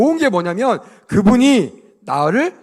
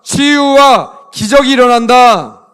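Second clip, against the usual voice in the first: loud, slow proclamation; loud, slow line for the kids to repeat back; loud call to repeat; 5 semitones higher, 10 dB louder, slower than usual. Shown as a demonstration. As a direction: belt it out slowly for them to echo. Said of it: "따라합시다".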